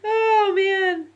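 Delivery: high sighing words after a laughing fit, settling down; real teary come-down from laughter; crying from laughing. Real, not acted. Streamer recovering after laughing till they cry, teary and high. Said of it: "now I'm crying again because I'm laughing".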